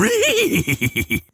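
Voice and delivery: a high cartoon-dog snicker; mock cartoon laugh pitched far above the speaking voice; Scooby-Doo laugh. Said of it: "I just felt like doing a Scooby-Doo laugh".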